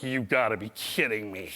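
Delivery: low, soft exasperated driver. Retold or imitated. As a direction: groan it like a fed-up driver. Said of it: "he's like".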